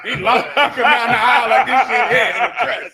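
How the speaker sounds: loud, laughing ridicule of the walk; laughing mockery. Real, not acted. Mocking the athlete's walk.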